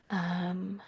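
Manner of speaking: murmured under the breath while searching; quiet whispered apology; whispered aside. Real, not acted. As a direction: whisper it to yourself, half off-mic.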